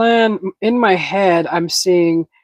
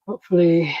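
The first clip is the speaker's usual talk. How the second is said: ordinary talk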